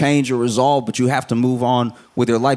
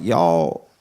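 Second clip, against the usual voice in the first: much lower voice; neighboring restaurant calling over; 10 semitones lower than usual. Acted. Chick-fil-A next door to the waiting crowd, low-voiced appeal. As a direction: drop low to get the crowd's attention. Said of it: "Chick-fil-A was next door being like".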